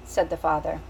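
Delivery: ordinary delivery, nothing marked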